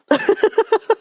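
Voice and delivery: sudden high, loud laugh. Real, not acted. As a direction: burst out laughing. Laughing at chat message.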